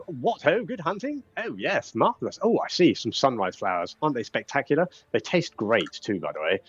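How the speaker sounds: jovial character voice, swooping up and down; Sir Talavar. Acted. Fairy dragon admires the hunters' finds, jovial and swooping. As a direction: greet them with hearty, delighted approval. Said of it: "So Talavar says".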